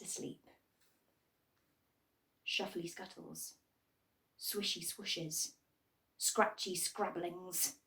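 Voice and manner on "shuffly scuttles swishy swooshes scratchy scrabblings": hushed. A narrator's reading. Mysterious night noises in story.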